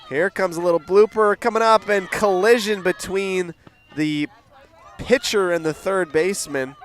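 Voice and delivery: fast, high, loud live call; excited play-by-play. Real, not acted. Commentator calls a blooper and fielders colliding, excited. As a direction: race along with the unfolding play.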